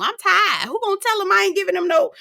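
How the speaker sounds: high, hurried mock whine; retold complaint. Retells Toya's complaint.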